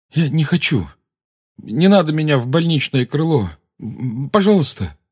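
lightly voiced boy, mumbling; a hint of a flustered boy; mumbling Harry. A narrator's reading. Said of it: "Гарри бормотал эти бессвязные слова".

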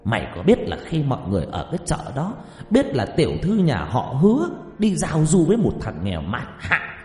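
high-pitched, indignant tirade; furious father. A narrator's reading. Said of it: "Hứa Bổn Hòa lập tức giận dữ". (người cha giận dữ quát mắng)

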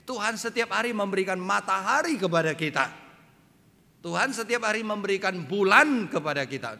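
high, loud pulpit proclamation; ringing sermon delivery, key words punched; forceful preaching. Real, not acted. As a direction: proclaim it boldly to the whole congregation.

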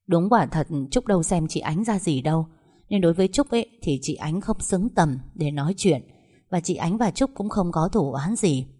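plain reading voice

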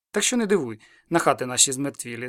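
plain reading voice

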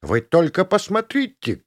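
high-pitched indignant cry; outraged warden's high holler; shouting warden. A narrator's reading. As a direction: call everyone to witness, pitched high. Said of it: "кричала она".

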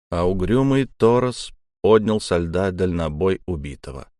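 usual speaking voice

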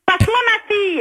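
angry. Real, not acted. Mother demanding her daughter.

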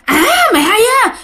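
very high, quick mock outburst; retold angry threat. Ghost answers mother's defiance, angrily threatening. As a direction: exclaim it like an affronted ghost about to lunge. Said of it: "bà nghe vậy cái bà nói".

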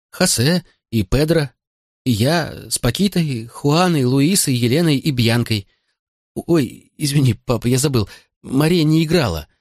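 high-voiced boy eagerly explaining; naive young son. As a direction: describe it with a child's innocent delight.

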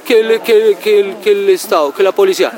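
high, exaggerated mock whine; mocking whiny complainer. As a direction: parody the crybabies blaming the state. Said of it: "no andar llorando y". (imitando burlonamente a un quejumbroso, voz aguda)

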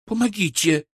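high, urgent cry; excited plea under strain; calling for help. Acted. Struggling with rod, calls for help.

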